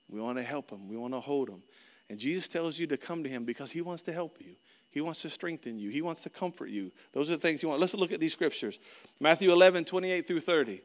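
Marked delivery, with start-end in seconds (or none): none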